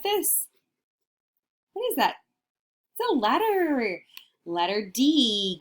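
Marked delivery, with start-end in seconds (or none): 1.7-4.0 high, curious puppet voice; a puppet wondering aloud. Pretend. Puppet asking the class, high and curious.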